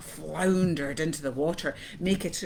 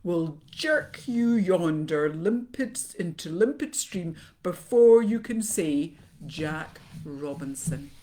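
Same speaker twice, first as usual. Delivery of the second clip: pompous python; 8 dB louder than usual. A narrator's reading. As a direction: declaim it grandly, easing off on the final name. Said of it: "This is the way the bi-coloured python rock snakes always talk".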